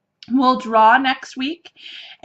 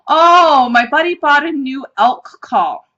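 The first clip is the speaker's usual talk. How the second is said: loud, high drawn-out "Oh," then explaining; retold realization; 4 semitones higher, 7 dB louder than usual. Husband explains the elk call to a worried wife, mock realization. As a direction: act out a husband suddenly catching on.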